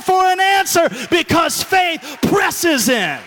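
loud rising sermon, slowing on the key line; full-force preaching. Acted. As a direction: preach it to the back row.

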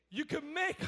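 usual speaking voice

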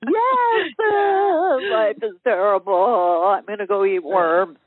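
mock wailing self-pity; Eeyore. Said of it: "like Eeyore".

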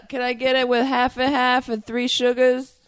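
high, hammed-up East Coast order; mock coffee customer. Boston-style Dunkin' customer order.

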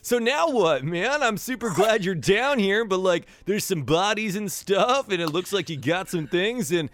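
high, loose dude voice, arms wide; surfer dude hug. Acted. Floon calling the pretty lady in for a hug, high dude voice. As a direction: pull her into a goofy grateful hug.

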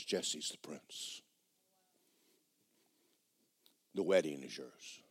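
slow, soft hushed reveal; secret whisper. Acted. Stewardess Angela revealing the groom to the girl, hushed whisper. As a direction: let the secret slip gently.

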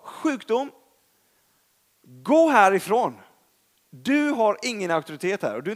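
rebuking cancer in loud holy anger; angry command. Retold or imitated. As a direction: order the sickness out with holy anger. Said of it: "bli väldigt arg på sjukdomen".

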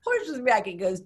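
usual speaking voice

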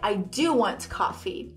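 slow, low phrase with stress on "do"; confirming, weighted "do"; emphatic DO. Shown as a demonstration. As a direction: lean on "do" to confirm. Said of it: "eu quero mesmo café".

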